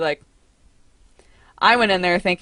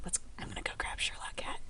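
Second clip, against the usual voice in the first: whisper; 17 dB quieter, faster than usual. Real, not acted. Going to fetch sleeping cat.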